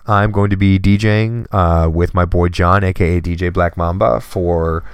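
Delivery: usual speaking voice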